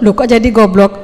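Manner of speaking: normal talking voice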